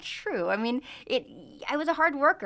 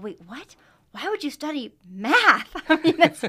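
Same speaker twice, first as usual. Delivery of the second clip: a mock-shocked skeptic; incredulous disbelief; 6 semitones higher, 8 dB louder than usual. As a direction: act out their stunned disbelief. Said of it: "how many people said".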